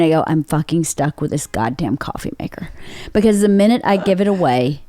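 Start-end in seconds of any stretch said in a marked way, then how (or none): 0.3-2.7 inner voice. Retold or imitated. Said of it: "silently in my brain".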